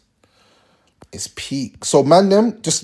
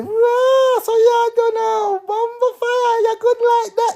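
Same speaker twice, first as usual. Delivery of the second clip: mock impressed girl; slower than usual. Impressed girl watching his moves, high excited squeal. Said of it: "She might start saying".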